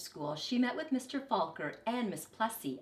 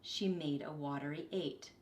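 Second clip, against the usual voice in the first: usual speaking voice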